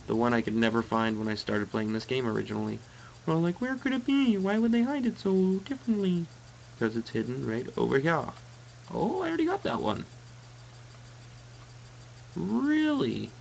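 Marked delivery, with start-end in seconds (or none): 3.3-6.3 very high, squeaky put-on wondering; mock bafflement. Mock bafflement at hidden game item. 8.9-13.3 mock disbelief. Mock disbelief over item already found.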